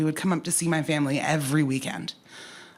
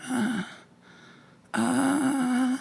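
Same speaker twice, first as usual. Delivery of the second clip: high, drawn-out "uh"; squeaky-pitched dodge of the question; hesitant noncommittal noise; 9 semitones higher than usual. Retold or imitated. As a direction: stall on a high, unsure "uh". Dodging whether she is happy.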